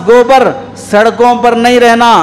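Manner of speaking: ordinary delivery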